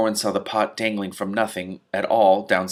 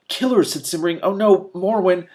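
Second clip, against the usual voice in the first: alarmed cry; 8 semitones higher, 5 dB louder than usual. Acted. Simrine cries out in alarm.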